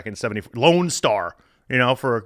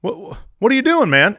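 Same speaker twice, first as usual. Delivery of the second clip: alarmed; 9 semitones higher, 5 dB louder than usual. Retold or imitated. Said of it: "he goes".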